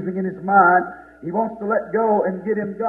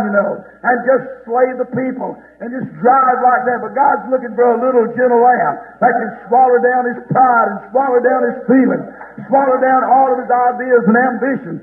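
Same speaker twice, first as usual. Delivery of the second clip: fervent preaching; 5 semitones higher, 6 dB louder than usual. Real, not acted. Preacher telling the church of John weeping, fervent preaching. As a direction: build into loud, driving revival preaching.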